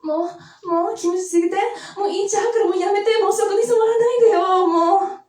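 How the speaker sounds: high, loud mock porn moans in Japanese; moaning in Japanese. Shown as a demonstration. Comic shows the crowd her Japanese, high hammed-up moaning. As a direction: squeal it like a hammed-up porn scene. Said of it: "demonstrate my Japanese for y'all".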